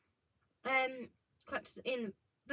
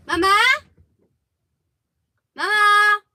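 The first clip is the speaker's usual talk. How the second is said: loud, high call for mum; raised voice, questioning upward call; 9 semitones higher, 15 dB louder than usual. Real, not acted. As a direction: call out to someone in another room. Calling for mother who isn't listening.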